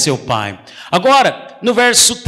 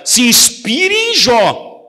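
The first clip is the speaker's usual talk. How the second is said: drawn-out exhortation with a shout on em; a rising preacher's cry; slow preacher's call; 4 semitones higher, much slower than usual. Real, not acted. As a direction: call it out slowly to the church.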